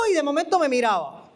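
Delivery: ordinary talk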